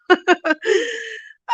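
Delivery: giddy high-pitched laugh through tears; high emotional laugh. Real, not acted. Vlogger overcome reading a subscriber's comment, teary laugh. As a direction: let a teary happy laugh out.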